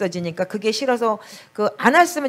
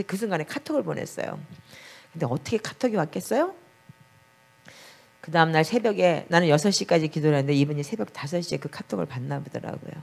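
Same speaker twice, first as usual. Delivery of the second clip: ordinary talk, nothing marked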